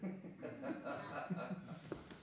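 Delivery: soft, voiceless laugh; breathy laugh. Acted. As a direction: let out a low snicker. Quiet laugh after the rant.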